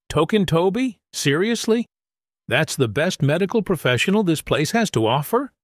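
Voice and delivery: high, scornful disbelief; mocking disbelief. A narrator's reading. Narrator mocking Toby to Lee, high and scornful. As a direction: tease him in front of everyone.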